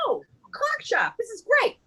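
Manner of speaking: high-pitched acted delight; delighted discovery. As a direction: play a man pleased by his find. Man delighted to find shop.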